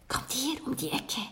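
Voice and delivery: hushed, secretive beckoning; whispered. A narrator's reading. Girl luring the children round the corner, whispering. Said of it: "hörten sie da jemanden flüstern".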